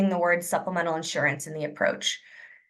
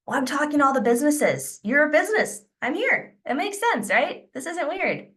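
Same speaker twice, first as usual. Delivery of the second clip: high-pitched casual justification; breezy reassurance; 6 semitones higher than usual. Acted. Trainer as rep explaining the visit to a prospect, breezy reassurance. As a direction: lift the pitch, light and matter-of-fact.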